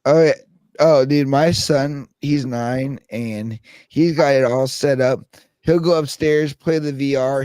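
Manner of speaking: ordinary talk, nothing marked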